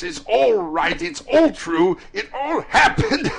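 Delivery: high, loud, building exultation; exult, on the edge of laughter; ecstatic cry. A narrator's reading. Scrooge realising it all happened, ecstatic.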